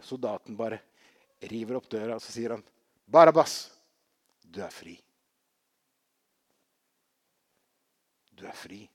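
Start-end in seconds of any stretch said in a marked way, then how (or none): 3.1-3.7 sudden loud call of a name; soldier's shout. Retold or imitated. Soldier calls the prisoner Barabbas at the cell door, loud shout. 4.4-8.8 soft release. Retold or imitated. Soldier tells prisoner he's free.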